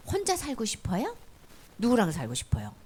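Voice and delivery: normal talking voice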